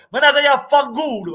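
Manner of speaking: high, exasperated curse hurled at the players; furious curse. Real, not acted. Fan cursing his own players after conceding, angry. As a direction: spit it out at your own team in disgust.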